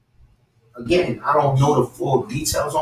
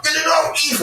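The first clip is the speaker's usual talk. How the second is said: high, squeezed, urgent mimicry; retold urgent undertone; 13 semitones higher than usual. Nervous Joe pressing Eve at the table, high strained mimicry. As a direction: imitate a nervous man pleading under strain. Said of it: "like, under his breath".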